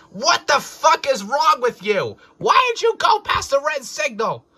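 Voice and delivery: high, loud angry scolding; cross old man. A narrator's reading. Old man scolds crew for passing red signal, furious. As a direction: tear into them at full volume. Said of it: "The old man was cross".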